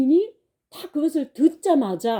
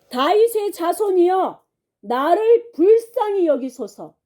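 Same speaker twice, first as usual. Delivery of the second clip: higher, stronger pleading cry for mercy; louder retold cry; 6 semitones higher than usual. Blind man crying louder to Jesus despite the crowd, pleading shout. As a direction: cry out harder, with everything left. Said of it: "이 사람이 더 크게 소리 질렀죠".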